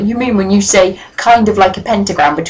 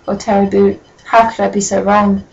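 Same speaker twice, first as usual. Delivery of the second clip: usual speaking voice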